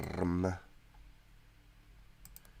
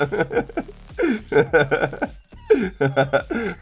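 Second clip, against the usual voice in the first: higher burst of laughter; laughing; 11 semitones higher, 5 dB louder than usual. Real, not acted. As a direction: keep laughing at it. Continued laughing at story.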